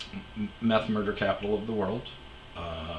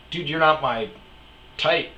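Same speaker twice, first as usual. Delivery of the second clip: higher, louder mock retort; deadpan comeback; 6 semitones higher, 5 dB louder than usual. To the yelling stranger, a raised mocking retort. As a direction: throw it back at him. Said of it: "And I'm like".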